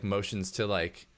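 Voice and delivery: ordinary talk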